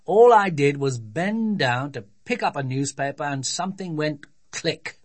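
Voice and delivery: put-on father's voice, aggrieved; grumpy voice. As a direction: complain like a put-out dad. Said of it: "in a grumpy voice".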